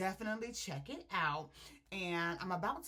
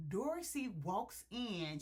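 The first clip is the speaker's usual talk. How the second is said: normal talking voice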